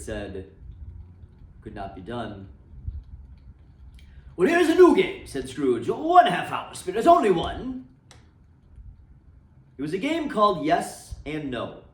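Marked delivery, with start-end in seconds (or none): 4.4-5.3 put-on high, loud, boyish plea; eager begging. A narrator's reading. Scrooge pleading with the spirit to stay, high and eager. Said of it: "he begged like a boy". 5.9-7.9 put-on high, loud, boyish plea; eager begging. A narrator's reading. Scrooge pleading with the spirit to stay, high and eager. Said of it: "he begged like a boy".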